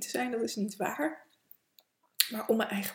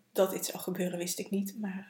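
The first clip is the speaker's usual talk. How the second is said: ordinary talk, nothing marked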